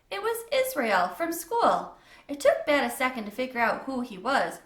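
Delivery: plain reading voice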